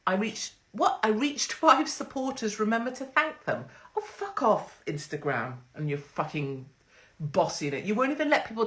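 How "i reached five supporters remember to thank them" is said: mock app notification. Mocking an Instagram notification in a higher, prissy voice. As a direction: parody the smug Instagram notice.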